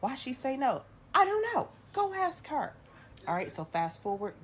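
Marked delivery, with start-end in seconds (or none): 0.0-0.8 high, eager gossip voices; nosy onlookers, pitched up; nosy bystanders. Retold or imitated. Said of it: "nosy people coming up like". 1.2-2.7 shrill, loud brush-off; snapping at the nosy passengers; exasperated. Retold or imitated.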